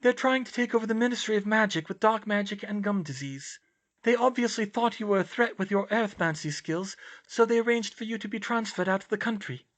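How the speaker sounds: high-pitched, airy character voice; dreamy high girl's voice; serene oddball girl. Acted. Luna explains her conspiracy seriously.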